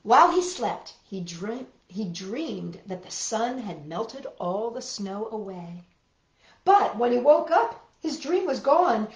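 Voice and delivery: put-on gentle read-aloud for small children; picture-book narration for little listeners; storybook reading. A narrator's reading.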